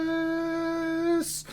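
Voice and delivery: very high, slow, squeaky stretched-out word; silly stretched word. Real, not acted. Gushing to viewers about the new kits, high and drawn out. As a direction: drag the word out up high in delight.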